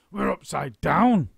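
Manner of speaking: slow, loud stunned cry; gasped. A narrator's reading. Mister Twit realising they are upside down. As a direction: gasp it out slowly. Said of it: "gasped Mister Twit".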